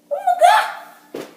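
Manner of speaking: high, loud, stretched gasp of a shout; startled shout. Real, not acted. Kid startled by the toaster, slow loud startled shout. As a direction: react as if the toaster just jumped.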